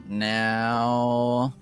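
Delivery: normal talking voice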